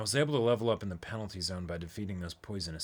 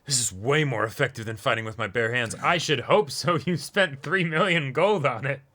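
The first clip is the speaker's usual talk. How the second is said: character voice; 4 semitones higher, 6 dB louder than usual. Acted. Voicing game character lines.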